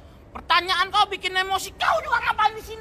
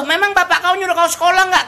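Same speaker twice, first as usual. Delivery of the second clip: ordinary talk, nothing marked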